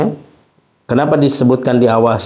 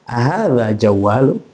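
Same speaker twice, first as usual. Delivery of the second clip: slow question rising at the end; "Ahadza jawaluk" drawn out as a question; question intonation. Shown as a demonstration. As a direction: ask "Ahadza jawaluk", lifting the last word. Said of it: "intonasinya mengantarkan itu adalah pertanyaan".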